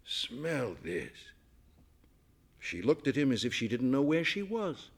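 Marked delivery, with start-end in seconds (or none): 0.0-1.3 soft murmur. A narrator's reading. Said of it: "he purred".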